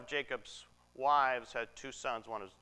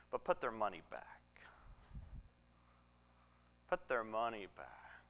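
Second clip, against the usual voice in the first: hushed, lower conspiratorial order; secretive; 5 semitones lower, 11 dB quieter than usual. Retold or imitated. Joseph secretly instructing about the sacks, in a hushed voice. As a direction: drop to a near whisper, as if in secret. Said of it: "Joseph secretly says".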